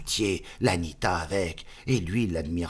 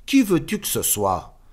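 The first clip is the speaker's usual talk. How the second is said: higher, louder retort; incredulous retort; 9 semitones higher, 7 dB louder than usual. Acted. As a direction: answer as if it's obvious. Friend's retort. (réplique incrédule, plus aiguë et forte)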